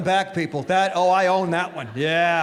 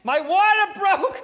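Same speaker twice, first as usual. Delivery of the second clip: high mock yell from the wife; 9 semitones higher, 3 dB louder, slower than usual. Wife yelling that labor has started, mock high cry. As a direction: shout the news up high, half-joking. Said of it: "she yells".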